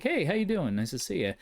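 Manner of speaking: high, chirpy mock greeting; hammed-up friendly creature saying hi; friendly monster greeting. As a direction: play the cheerful thing in the cabinet. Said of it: "they'll just say hello".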